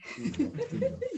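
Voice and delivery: high breathy nervous laugh; nervous giggle. Real, not acted. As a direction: laugh off the jitters. Nervous before reading scripture.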